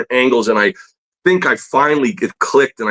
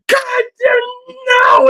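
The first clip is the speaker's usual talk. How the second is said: much higher furious outburst; imitating an angry teacher; slower than usual. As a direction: explode at the redrawn page. Teacher explodes again.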